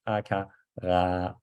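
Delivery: slow, low "Ah, ka, ra", R at the back of the throat; Danish R drill. Shown as a demonstration. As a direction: build from "Ah" through "ka" to a guttural "ra". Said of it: "turn the K into an R".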